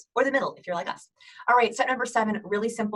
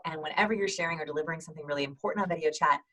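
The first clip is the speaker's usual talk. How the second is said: ordinary delivery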